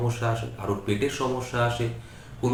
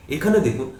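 ordinary talk, nothing marked

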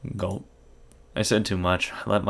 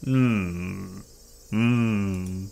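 normal talking voice

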